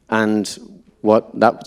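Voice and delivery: usual speaking voice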